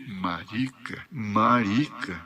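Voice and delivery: slow, low repeat of an insult; brooding echo. Retold or imitated. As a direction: draw out each MARICA, low and soft. Interpreter echoes the brooded-over insult.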